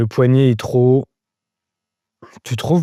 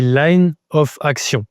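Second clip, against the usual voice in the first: slow, deliberate French accent on the English name; accent français; 2 semitones higher, much slower than usual. Shown as a demonstration. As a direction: say LINE OF ACTION slowly with a French accent. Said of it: "Je te le dis avec l'accent français". (avec l'accent français, lentement)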